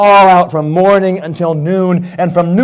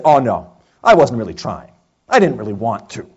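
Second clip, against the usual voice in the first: sheepish excuse; 8 semitones lower than usual. Retold or imitated. Child makes excuses after failing.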